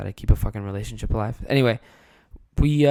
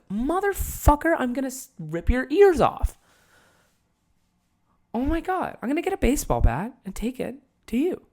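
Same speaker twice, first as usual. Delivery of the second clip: mock inner rage; 12 semitones higher than usual. Kid raging at stepdad inside.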